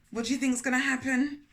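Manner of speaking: plain reading voice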